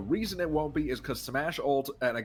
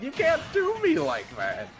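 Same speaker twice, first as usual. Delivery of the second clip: mock whine; 6 dB louder than usual. Real, not acted. Joking complaint about home themes.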